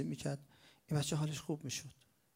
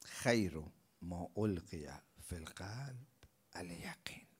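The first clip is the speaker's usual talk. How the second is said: slow hushed whisper of a sacred phrase; whispered saying; 5 semitones lower, 6 dB quieter, slower than usual. Acted. Preacher closing with a holy saying, soft whisper. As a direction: breathe the words out like a secret.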